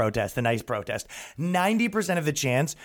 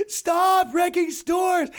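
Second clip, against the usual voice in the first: high slow shouted scolding; yelling; much slower than usual. Retold or imitated. Activist yelling at rioters wrecking a store, mock shout. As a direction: yell at them like a scolding activist. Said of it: "she's like".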